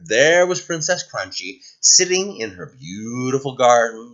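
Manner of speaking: ordinary delivery, nothing marked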